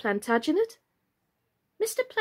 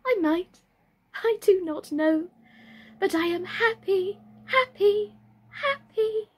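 high, bright doll voice rising on each happy; happy Birdie; 11 semitones higher than usual. Acted. Birdie telling herself how happy she is, high. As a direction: let each happy climb higher. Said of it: "asked Birdie".

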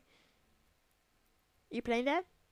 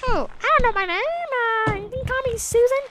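Susan voice; 8 semitones higher, faster than usual. Acted. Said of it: "That's Chimney Swift's Susan person".

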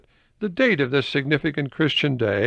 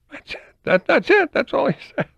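much higher, incredulous laughing; amused disbelief; 4 dB louder than usual. Real, not acted. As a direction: squeal it in happy disbelief.

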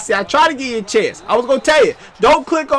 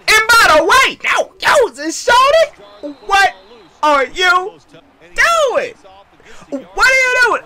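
high-pitched, drawn-out taunting squawk; high comic voice of disbelief; mock disbelief; 10 semitones higher, 3 dB louder, slower than usual. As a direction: squeal it in exaggerated outrage, stretching the words. Repeats the mock outraged catchphrase.

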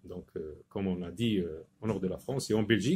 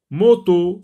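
Moto, much higher and louder; called-out word; 7 semitones higher, 10 dB louder than usual. Shown as a demonstration. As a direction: call out Moto to the class. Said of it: "teacher calls out the word". (Moto lancé fort et aigu)

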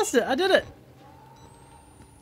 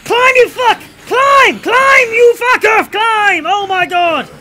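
frantic yells, much higher and louder; panicked shouting; 14 semitones higher, 15 dB louder, slower than usual. Real, not acted. Chased player yells at his own character, panicked shout. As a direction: scream at him to climb before it's too late.

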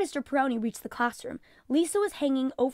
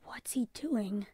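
nervous whisper; 5 dB quieter, slower than usual. Acted. Nervous mother eyeing the snake.

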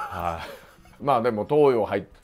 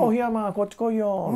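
lightly imitated friend calling out, higher; 友達の呼びかけ; 9 semitones higher than usual. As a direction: call a mate over in a raised pitch. 食堂で友達に呼ばれる再現. (友達が呼ぶ声を軽く高めに再現)

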